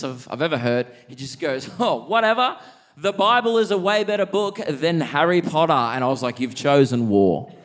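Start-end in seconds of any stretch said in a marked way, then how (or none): 1.8-5.7 high, mocking know-it-all kid; lightly voiced smug schoolkid, slightly louder. Kid delivers smug comeback. 6.4-7.5 slow, grave mock declaration of war; hammed-up slow menace; mock dramatic. Dramatic reply to comeback.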